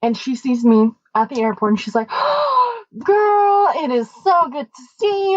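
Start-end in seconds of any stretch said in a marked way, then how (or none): 2.1-2.9 high delighted gasp; excited gasp. Retold or imitated. Chaperone spotting her student at the airport, gasping happily. 3.0-5.4 gushing greeting. Retold or imitated. Chaperone greeting her former student, gushing.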